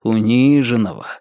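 plain reading voice

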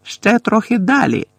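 loud high call over her shoulder; shouting over shoulder. A narrator's reading. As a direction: call it back loudly as she hurries ahead. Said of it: "голосно крикнувши через плече".

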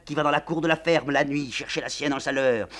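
high, loud, obsessive litany; raving madwoman. Acted. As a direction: rant it at them. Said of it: "La générale continue".

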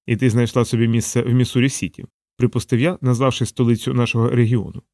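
usual speaking voice

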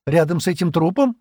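high, incredulous question; surprised. A narrator's reading. Джемма asks about waiting by a corpse, surprised. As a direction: ask in startled disbelief. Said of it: "удивилась Джемма".

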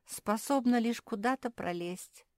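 high, slow, self-pitying; small acted voice of complaint; plaintive complaint. A narrator's reading. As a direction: whine mildly about being useless. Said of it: "пожаловалась Перла".

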